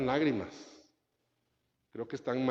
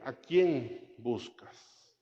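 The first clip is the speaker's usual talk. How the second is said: gentle question; much slower than usual. Acted. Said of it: "la segunda pregunta dice".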